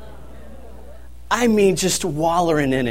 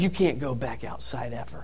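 much softer, lower mock-stern verdict; stern low warning; 7 semitones lower, 12 dB quieter than usual. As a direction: deliver the sentence flat and final, quietly. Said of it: "I said".

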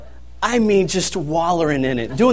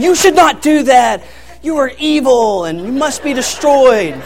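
mock lecture; 6 semitones higher, 5 dB louder than usual. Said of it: "I'm, I'm, I'm lecturing him".

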